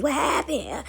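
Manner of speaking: mock bewildered calling, one by one; confused takers. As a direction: call out each one, lost and needy. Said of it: "they all confused".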